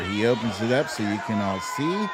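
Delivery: ordinary talk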